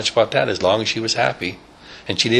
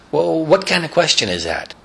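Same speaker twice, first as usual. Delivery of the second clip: put-on puzzled old lady; 10 semitones higher than usual. Stelta puzzled.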